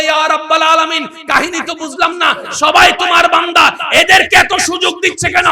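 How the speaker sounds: high, full shout; the sun demanding an answer. Acted. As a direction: hurl the sun's question up to heaven. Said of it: "সূর্য বলে".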